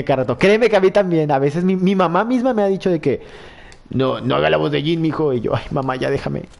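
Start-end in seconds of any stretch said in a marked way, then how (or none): none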